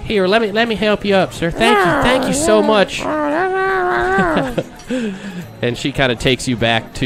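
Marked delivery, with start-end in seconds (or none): 0.1-3.0 high, sweet woman's voice; Daisy helping the stranger up; saloon girl Daisy. Acted. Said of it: "she says". 3.1-5.6 put-on high girlish giggle. Daisy giggles in character.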